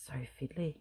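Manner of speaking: whispered to herself; breathy whisper while concentrating. Real, not acted.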